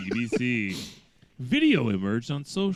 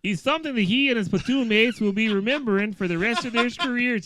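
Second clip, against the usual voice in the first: put-on light Canadian accent, a bit higher; 10 semitones higher, 4 dB louder than usual. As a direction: quote the officer in a Canadian accent. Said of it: "I gotta put on my Canadian voice".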